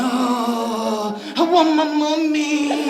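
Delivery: put-on high, slow childish whine; whiny drunk. Drunk friend whining to the wife, slow high childish whimper. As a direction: whimper it like a sick little boy.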